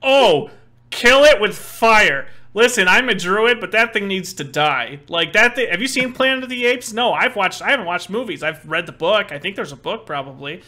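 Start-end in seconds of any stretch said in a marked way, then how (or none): 0.0-2.3 horrified shout. Acted. Druid reacts in horror to speaking animal. 2.5-9.3 alarmed rant. Acted. Panicked druid rant at researcher.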